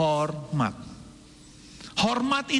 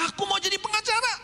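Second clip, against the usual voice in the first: put-on much higher, fast eager kid voice; child's wish; 12 semitones higher, faster than usual. Child telling parents he'll be a lawyer, high eager voice. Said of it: "Misalnya mau jadi pengacara".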